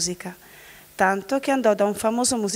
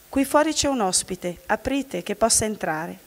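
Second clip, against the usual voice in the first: plain reading voice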